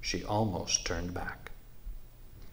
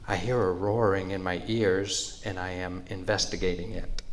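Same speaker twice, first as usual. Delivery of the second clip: usual speaking voice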